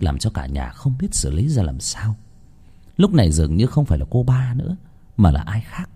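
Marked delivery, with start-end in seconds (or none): none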